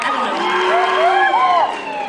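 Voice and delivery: very high, slow squeaky drawl; drawn-out squeak. Acted. Comic aside squeaked out very slowly.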